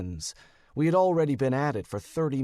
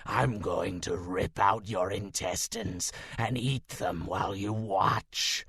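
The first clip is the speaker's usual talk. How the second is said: low rasping monster threat; slow, low, savoring the threat; menacing werewolf; 4 semitones lower than usual. Acted. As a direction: werewolf growling at his prey.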